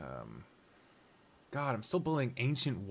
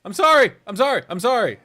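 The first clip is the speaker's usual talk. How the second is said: loud, high, repeated yelps; frantic mock apology; 9 semitones higher, 11 dB louder, faster than usual. Frantic apology to game leader.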